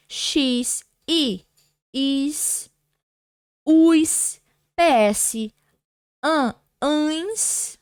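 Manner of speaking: each ending set apart by pauses; dictated endings. Shown as a demonstration. Teacher to students listing paroxytone endings, dictated. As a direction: dictate the list for note-taking. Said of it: "terminam em, olha só".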